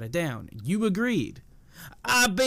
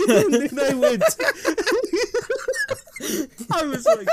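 laughing; 13 semitones higher than usual. Real, not acted. Laughing through the story.

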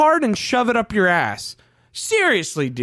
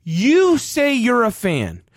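normal talking voice

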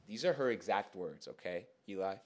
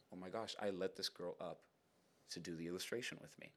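low, hushed aside to self; dismayed inner voice; 5 semitones lower, 12 dB quieter than usual. Retold or imitated. Inner dread as she talks.

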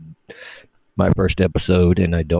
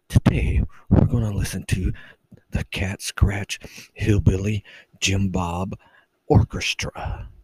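mock the sleepy radio host, nearly whispering; droning NPR announcer parody. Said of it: "they always talk like this".

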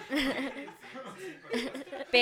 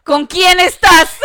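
slow, drawn-out suspicious question, much louder and higher; mock jealous demand; 5 semitones higher, 12 dB louder, much slower than usual. Friend teasing her like a jealous partner, mock suspicious demand. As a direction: tease her like a jealous girlfriend.

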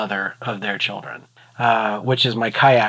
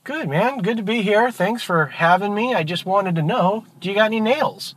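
high-pitched, cheery duck; sweetly polite duck, pitched well up; breezy duck; 7 semitones higher than usual. Acted. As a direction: play it innocent in a raised voice. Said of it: "The duck goes".

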